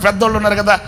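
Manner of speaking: ordinary delivery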